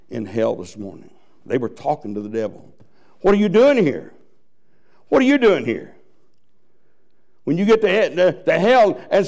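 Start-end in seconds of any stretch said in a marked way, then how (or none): 3.2-6.0 higher, pointed question; accusing damned souls. Acted. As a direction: demand why they're here. Souls in hell questioning newcomer.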